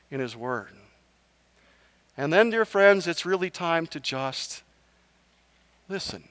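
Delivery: tender closing preach to dear friends; gentle sermon appeal. Acted.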